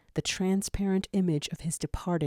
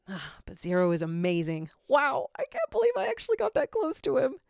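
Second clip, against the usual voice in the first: high, rushed dreamy awe; starstruck gush; 7 semitones higher, faster than usual. Acted. Gadget swooning about getting close to Zero, high and fast. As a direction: swoon over your hero.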